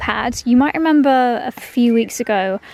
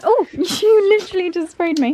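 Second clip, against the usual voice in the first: higher-pitched, amused yelp of surprise; startled laughing protest; 7 semitones higher, 3 dB louder than usual. Real, not acted. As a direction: react laughing to a sudden spray. Accidentally sprayed with water mid-sentence.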